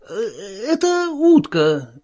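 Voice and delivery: high, slow, wavering repetition; nervous Marvel. A narrator's reading. Marvel repeating the voice's prompt, nervous and high. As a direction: echo it haltingly in a high voice.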